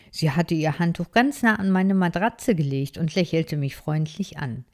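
ordinary delivery, nothing marked

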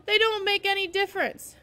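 high, loud, distressed complaint; loud, high-pitched childlike protest; upset protest. A narrator's reading.

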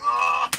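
a high, loud knowing "ah"; a loud smug grunt at the seller. Retold or imitated. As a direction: let out a teasing, knowing "ah". His own smug reply retold.

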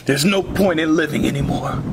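very low, hopeless murmur; despondent. Acted. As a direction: sink into gloomy resignation. Depressed father staring at rain.

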